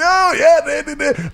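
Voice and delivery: put-on high, loose drunken rambling; drunk friend babbling. Drunk friend on the phone, high rambling babble. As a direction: slur along like a wasted buddy. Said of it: "he's going".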